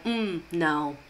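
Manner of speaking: imitating an official brushing off a veteran; mock curt refusal. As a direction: shut them down with a bored little no. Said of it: "they were like".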